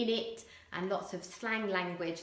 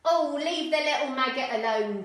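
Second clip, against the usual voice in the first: higher, louder Cockney woman; Cockney scolding; 6 semitones higher, 9 dB louder than usual. Acted. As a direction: snap at him in Cockney. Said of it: "Doll said".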